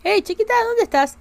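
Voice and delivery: high sing-song call to a little one. Real, not acted. Calling her sim daughter to come, high and sing-song. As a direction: raise the pitch and call her sweetly.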